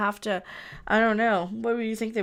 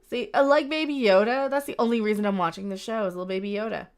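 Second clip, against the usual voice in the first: ordinary talk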